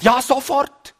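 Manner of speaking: God's eager, high-pitched reply, acted; emphatic instant yes. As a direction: jump up high on the answer. Acted instant answer from God. (begeisterte, sofortige Zusage)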